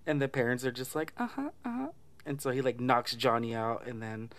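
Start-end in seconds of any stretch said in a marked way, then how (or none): 1.2-1.9 much higher sing-song uh-huh; mock eager agreeing. Parents eagerly agreeing with the dad, high and chirpy.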